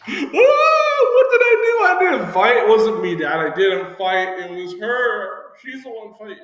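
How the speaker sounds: shrill; high loud mock protest. Child protesting unfair spanking.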